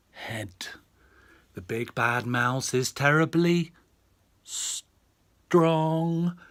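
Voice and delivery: put-on lively read-aloud to a child; storyteller voice for a little girl; bedtime story reading. A narrator's reading. Dad reads bedtime story.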